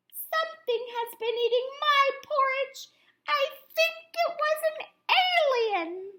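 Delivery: very high, small cub voice; little bear voice. A narrator's reading. Little Wee Bear telling his parents about the broken chair, very high. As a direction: talk like a tiny bear cub, worried.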